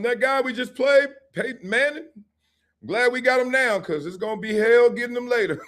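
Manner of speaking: high, laughing coach line; laughing quote. Retold or imitated. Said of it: "let me say this".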